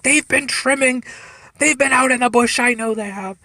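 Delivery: high-pitched, insistent protest; imitating frantic young cop. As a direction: plead your case like a worked-up rookie.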